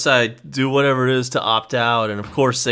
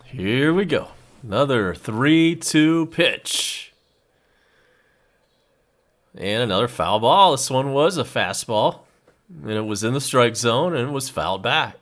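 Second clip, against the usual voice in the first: play-by-play; 2 semitones higher than usual. Acted. Live baseball play-by-play call.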